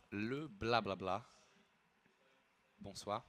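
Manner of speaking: playful, low French babble; going full French. Pretend. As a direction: toss it off softly in French. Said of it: "why are you going full French".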